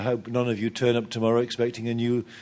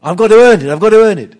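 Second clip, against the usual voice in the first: much louder, higher, insisting; insistent cry; 6 semitones higher, 13 dB louder, much faster than usual. Retold or imitated. Believer protesting to God, loud insistent cry. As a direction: hammer home that you must earn it. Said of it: "we don't accept this".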